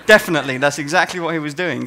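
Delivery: loud, laughing agreement; real laughter through the words. Real, not acted. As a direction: laugh it out, amused. Laughing at testers' mistake.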